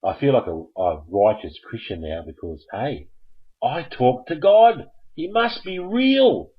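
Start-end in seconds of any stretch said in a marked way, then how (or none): 3.6-6.5 higher, louder and slow, thrilled; excited boast. Pretend. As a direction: proclaim it, beaming with pride.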